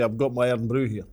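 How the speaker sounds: 1 mock Scottish accent. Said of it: "why do B B C News readers not say".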